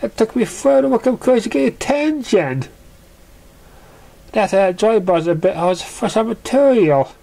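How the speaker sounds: high, complaining pony voice; whiny pony Surprise. Acted. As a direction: pour out the jealousy in a rush.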